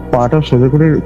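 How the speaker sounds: ordinary delivery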